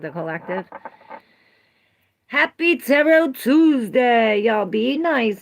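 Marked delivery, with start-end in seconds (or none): 2.3-5.4 high, loud, slow announcer greeting; hype opener. Real, not acted. Opening the tarot stream for viewers, loud and slow. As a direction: open the show with a big welcome.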